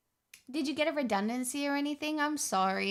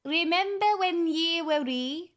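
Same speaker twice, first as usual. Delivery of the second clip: higher, put-on Scottish voice; Scottish accent; 4 semitones higher than usual. Shown as a demonstration. Streamer showing off to viewers, Scottish accent. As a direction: lay on a lilting Scottish accent. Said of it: "would you like my Scottish accent?".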